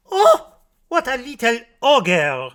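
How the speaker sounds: high, loud exclamation; exclaiming maid. A narrator's reading. Maid reacting to the greedy little girl, exclaiming. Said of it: "says the maid".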